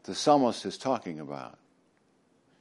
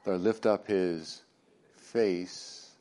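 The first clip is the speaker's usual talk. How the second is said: solemn blessing; 2 semitones lower, 6 dB quieter than usual. Acted. Reads the priestly blessing.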